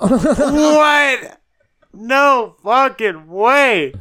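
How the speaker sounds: slow, loud, high no fucking way; stunned disbelief. Real, not acted. Host reacting to a wild costume, drawn-out disbelief. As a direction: drag it out in shock.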